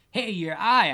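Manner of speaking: loud, high call. Acted. Narrator calling after the crying woman, loud.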